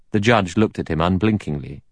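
ordinary delivery

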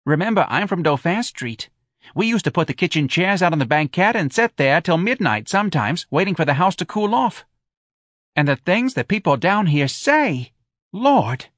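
high, animated New Orleans mother; high-pitched, indignant local gossip; dramatic Missus Riley. Acted.

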